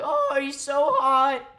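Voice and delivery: slow, high, loud fan squeal; exaggerated teenage shriek; mock freak-out. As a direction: swoon hysterically as a starstruck teen. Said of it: "freaks out and is like".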